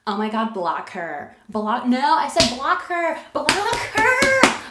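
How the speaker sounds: mock outraged yell climbing to a screech; raging girlfriend. Girlfriend ordering boyfriend to block the ex, raging. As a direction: build to a high, loud, hammed-up demand. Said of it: "I would be that raging bitch that your girlfriend's like".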